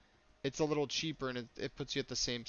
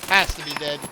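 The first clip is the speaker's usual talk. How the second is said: tense shout; 2 semitones higher, 15 dB louder than usual. Real, not acted. Tense raid firefight.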